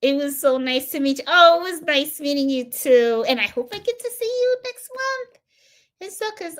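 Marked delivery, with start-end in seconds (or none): 1.3-6.6 bright goodbye. Real, not acted. Warm goodbye to viewer.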